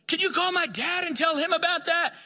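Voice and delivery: very high, eager mimicked request; amazed pleading. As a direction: plead excitedly in a high put-on voice. Repeats Jesse's amazed request.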